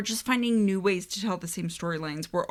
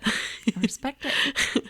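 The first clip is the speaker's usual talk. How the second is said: drawn-out approval; 4 semitones higher, much slower than usual. Real, not acted.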